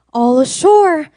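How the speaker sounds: loud, high announcing call; shouted call. A narrator's reading. Sailors call everyone ashore at New York, loud. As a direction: call it across the dock. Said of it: "the men shouted".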